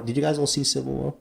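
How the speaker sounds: ordinary delivery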